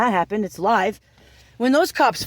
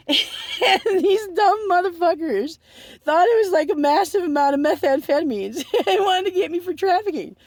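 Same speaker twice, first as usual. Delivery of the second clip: laughing while talking; 6 semitones higher than usual. Real, not acted. Laughing while telling the story.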